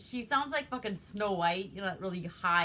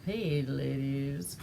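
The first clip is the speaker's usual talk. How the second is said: smooth guy; 6 semitones lower, 4 dB quieter, slower than usual. Retold or imitated. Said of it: "And he's like".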